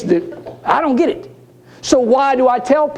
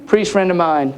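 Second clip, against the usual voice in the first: usual speaking voice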